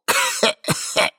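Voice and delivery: acted high wheezy coughing fit; staged repeated coughing; old man's cough. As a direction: cough like a doddering old man.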